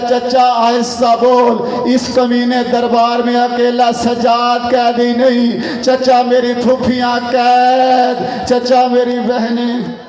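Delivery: slow, wailing plea to an uncle; grieving appeal. Acted. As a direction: drag the words out in mourning. Captive's lament at the climax.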